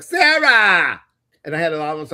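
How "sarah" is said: high holler of Sarah, falling on a long AH; long, loud name-call. Retold or imitated. Medium relays how the grandmother called her, long loud holler. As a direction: imitate a grandmother bellowing a name. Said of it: "the grandmother was like".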